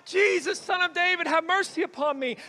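high, shouted plea; crying out. Retold or imitated. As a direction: cry out for mercy on someone's behalf. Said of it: "there are people crying out".

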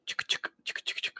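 soft rapid pen-click noises; quiet unvoiced "chick-chick" sound effect; imitated clicking. Shown as a demonstration. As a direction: click softly like buttons being pushed. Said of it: "like, the sound of".

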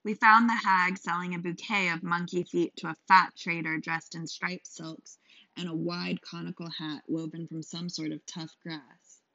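ordinary delivery

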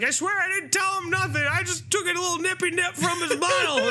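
high, cracked begging voice; pleading homeless man. Retold or imitated. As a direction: swear innocence like a scared drunk. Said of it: "he's like".

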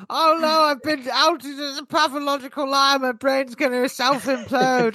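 high, squeaky mock panic; outed liar squealing in mock panic; mock panicked liar. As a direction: play the exposed liar in a hammed-up fright.

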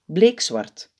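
ordinary delivery, nothing marked

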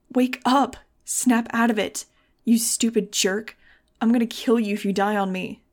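loud, forceful angry plea; desperate yelling. A narrator's reading. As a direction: yell as if to rouse the unconscious. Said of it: "I was yelling right into it".

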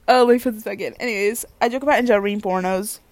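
normal talking voice

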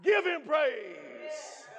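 high, shouted testimony; full-force preaching. Real, not acted. Preacher testifying he woke up excited about Christ, shouted preaching.

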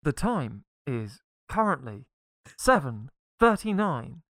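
slow, even recorded time announcement; talking clock. Retold or imitated. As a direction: read the time like the speaking clock. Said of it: "it would say".

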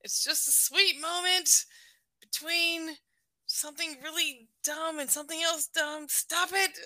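high-pitched exasperated telling-off of yourself; mock self-scolding. Acted scolding of own teariness.

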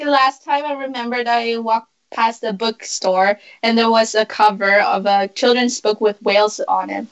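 ordinary talk

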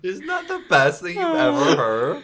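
high, loud, laughing. Real, not acted. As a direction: laugh so hard it nearly cries. Laughing after reading bio.

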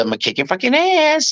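high-pitched comic bully; put-on character voice. Made-up movie catchphrase, high comic bully voice. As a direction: threaten in a squeaky high voice. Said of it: "I'm gonna talk like this".

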